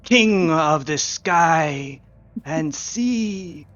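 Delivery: slow, grand roll call of titles; stately, stretched proclamation; mummy herald. Acted.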